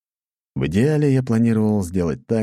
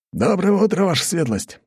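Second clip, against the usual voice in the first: cheerful greeting; 13 semitones higher than usual. A narrator's reading. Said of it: "радостно улыбнулся дружинник".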